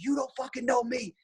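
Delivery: high defiant snarl of a hothead; mocking the kid's defiance. Gym kid squares up before lunging, high and defiant. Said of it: "he's like".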